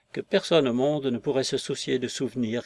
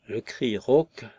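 ordinary delivery

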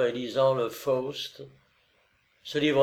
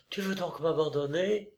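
higher, softer, stricken voice; dismayed question; 5 semitones higher, 5 dB quieter than usual. A narrator's reading. Olga to her lover on parting, dismayed. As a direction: ask it in hushed dismay. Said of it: "s'écria-t-elle en pâlissant".

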